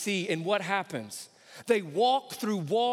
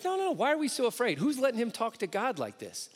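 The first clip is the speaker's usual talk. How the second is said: indignant protest; 7 semitones higher, faster than usual. Retold or imitated. David protesting to the soldiers.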